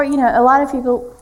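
normal talking voice

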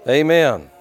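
ordinary delivery

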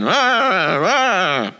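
high growly "rah rah" noise; mock angry clamor. A narrator's reading. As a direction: imitate a squabbling crowd for children. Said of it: "The sound of angry voices filled the garden".

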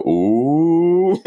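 high drawn-out schoolkid ooh; classroom ooh. Retold or imitated. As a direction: tease like kids when trouble starts. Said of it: "everybody goes like".

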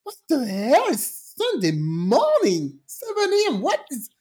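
incredulous groan rising to a squeak; mock outraged disbelief. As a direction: imitate woken neighbours, stretching the words. Mimics neighbours woken on Sunday.

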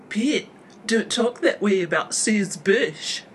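high, loud, slow lisp; lisping blurt. A narrator's reading. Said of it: "blurts out with a lisp".

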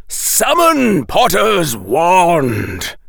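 loud, slow, drawn-out roared command; bellowed urgent order. A narrator's reading. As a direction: roar each word out long and loud. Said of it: "roared Professor Quirrell".

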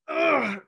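imitated growl of frustration; throaty, drawn-out "ugh"; frustrated groan. Quarterback after an interception in practice, frustrated groan.

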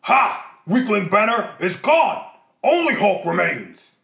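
loud, slow monster roar; Hulk voice. Acted. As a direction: bellow it like a brute. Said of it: "The Hulk ... gives it a shot regardless".

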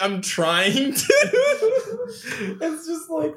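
high squeaky quote broken by laughter; laughing. Real, not acted. Quoting through laughter.

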